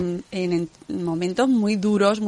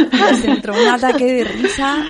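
laughing through the words; can't stop laughing while speaking; 5 semitones higher, 6 dB louder than usual. Real, not acted. Retelling a funny wake moment.

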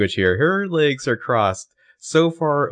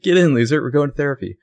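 loud, high mock summons; playful bossy order; 6 semitones higher, 5 dB louder than usual. Joking as Hannah summoning Emily to therapy, playfully bossy. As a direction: call it out cheekily, pitched up.

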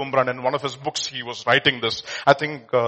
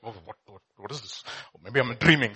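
mock shock; 7 semitones lower, 6 dB quieter than usual. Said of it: "He's shocked and he's like".

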